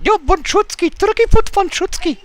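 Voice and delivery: high, soft odd character; silly character voice. Acted. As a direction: play a goofy stranger. Plays Turkey Foot character.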